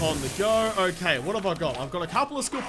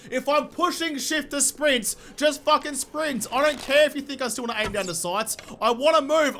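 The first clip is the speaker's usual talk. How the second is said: annoyed rant; 7 semitones higher, 3 dB louder than usual. Real, not acted. Ranting at sprint controls.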